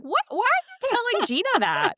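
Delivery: high-pitched imitation of a baffled mom; mock astonishment. Mom questions daughter about oversharing, mock astonished.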